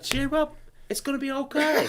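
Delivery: high, soft consoling voice; mock nice chef. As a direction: console them sweetly, imitating the chef. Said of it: "all nice to him and shit".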